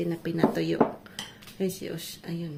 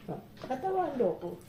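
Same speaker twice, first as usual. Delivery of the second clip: normal talking voice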